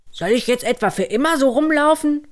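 hoch, schnell und empört; sauer. Acted. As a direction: beschwert sich aufgebracht. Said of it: "der war nun wirklich sauer". (verärgerter Vater Möhre)

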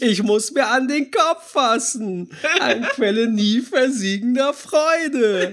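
high, slow teleshopping host voice; Nelly von Sale. Retold or imitated. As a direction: gush like an over-the-top TV shopping host. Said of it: "so gut aus Switch".

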